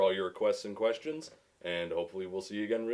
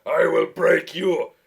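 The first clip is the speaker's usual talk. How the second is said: booming, slow, high villain bellow; Bane voice; 12 semitones higher, 15 dB louder, slower than usual. Retold or imitated. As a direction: bellow it like a hammy supervillain. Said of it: "Bane from Batman and Robin".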